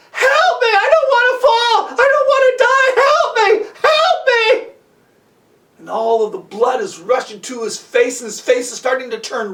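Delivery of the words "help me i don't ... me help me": high, loud screams for help; panicked cries. Acted. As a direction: cry out desperately, voice pitched way up. Boy dangling upside down, pleading.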